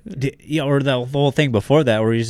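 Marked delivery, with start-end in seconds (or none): none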